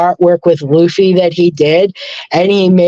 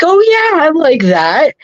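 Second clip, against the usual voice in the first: eager reply; 8 semitones higher than usual. Retold or imitated. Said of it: "I'm like".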